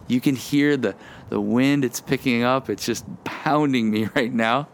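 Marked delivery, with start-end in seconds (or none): none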